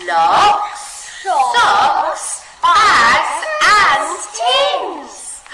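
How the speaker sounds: and stretched long, high and loud; listing with a huge drawn-out and; expressive list. Shown as a demonstration. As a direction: draw out and to show the mess. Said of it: "read that bit together again".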